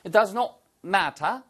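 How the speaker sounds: matter said the British way, crisp t, ma-tah; slow British model of matter; British-accent demo of matter; British pronunciation. Shown as a demonstration. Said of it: "똑같이 영국식 발음이잖아요".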